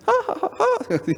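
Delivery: high mocking ha-ha; hammed-up laugh. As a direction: laugh like the carefree crowd.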